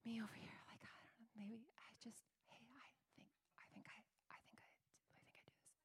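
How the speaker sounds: shy, hushed, hesitant whisper fading away; timid whisper. Pretend. Preacher mocks a timid believer, whispering meekly. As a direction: shrink into a nervous whisper.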